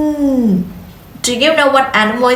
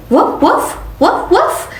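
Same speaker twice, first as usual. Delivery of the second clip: dog bark; 6 semitones higher than usual. Shown as a demonstration. Teacher imitates dog.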